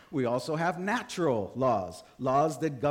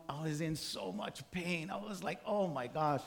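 soft, weak voice of a man in pain; pained hush; 8 dB quieter than usual. Retold or imitated. Shot salesman recalling lying in pain, soft and weak. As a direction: murmur it like lying hurt on the ground.

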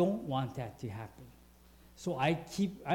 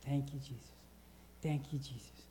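soft repeated prayer; 2 semitones lower, 9 dB quieter than usual. Shown as a demonstration. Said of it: "And just repeat that".

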